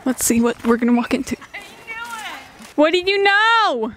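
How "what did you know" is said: high, slow playful call; high sing-song. Real, not acted.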